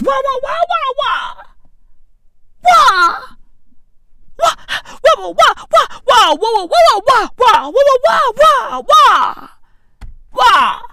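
very high-pitched wah-wah squawking; mock gibberish wailing. Comedian repeating the accent as noise, squawking mimicry. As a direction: wail it over and over way up high.

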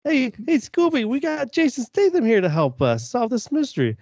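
high, bright-eyed cartoon gang voice; cartoon kid voice. Pitching a silly movie to a friend, high cartoon voice. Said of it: "You put Jason Statham in a Scooby-Doo movie".

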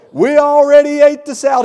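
high, loud dismayed cry; alarmed kids. Retold or imitated. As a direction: cry out as the kids who already ate it. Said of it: "And they go".

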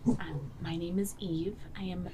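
soft, unvoiced, slow whisper; mysterious whisper to a bug. Druid announces herself to cockroach.

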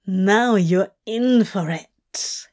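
slow, leering threat. A narrator's reading. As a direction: savour the threat, slowly. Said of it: "he leered".

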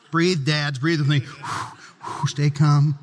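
low, soothing mock calm. Pastor jokingly calming frustrated dads, low and soothing. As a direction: talk the dads down gently. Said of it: "calming the dads".

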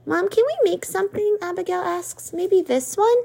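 put-on a girl begging to cook; eager little girl. A narrator's reading. Abigail pleading with mom.

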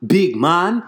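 put-on hawking fish to a tourist in a Jamaican accent; Jamaican vendor. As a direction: sell the fish like a cheerful island vendor. Jamaican fish seller voiced in story.

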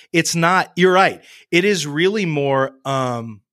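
usual speaking voice

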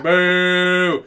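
loud, long mock boo; crowd booing. Audience booing the comics on stage, loud jeer.

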